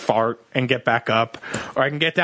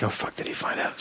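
whispered in alarm, much softer; panicked whisper; 10 dB quieter than usual. Retold or imitated. To himself when girlfriend asks, panicked whisper.

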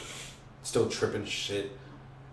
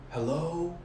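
imitated call; 6 semitones higher, 3 dB quieter than usual. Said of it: "someone's screaming".

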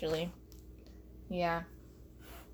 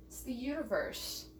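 much softer, falling tag; quiet aside; 11 dB quieter than usual. Acted. Comic repeating the point to themselves, quiet aside.

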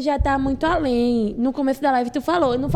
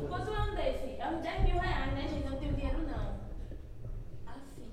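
quiet aside; 13 dB quieter than usual. Real, not acted.

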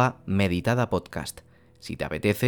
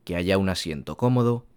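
guided meditation. Acted. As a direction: ease the listener into stillness.

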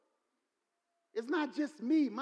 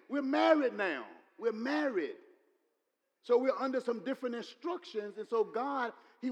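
ordinary talk, nothing marked

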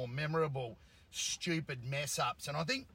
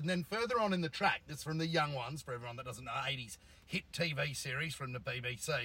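normal talking voice

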